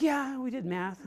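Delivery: put-on high, drawn-out kid's answer; imitated young son. Son answering dad's school questions, high drawn-out kid voice.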